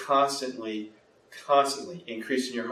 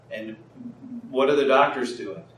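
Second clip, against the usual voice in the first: normal talking voice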